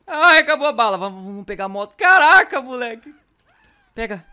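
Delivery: very high loud panic; panicked shriek. Real, not acted. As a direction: shriek as the ammo runs out. Out of ammo, panicking.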